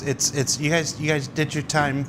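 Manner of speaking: ordinary delivery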